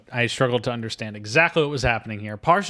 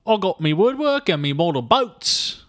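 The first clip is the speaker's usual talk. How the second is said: high, slow, hammy cockney; mock Travolta's British accent; bad British accent; 8 semitones higher, 6 dB louder than usual. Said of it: "the quality of the accent".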